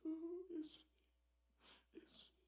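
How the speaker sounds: high, hushed crying voice; weeping cry. Acted. Mary weeping beneath the cross, a hushed high cry. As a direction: cry out his name through tears.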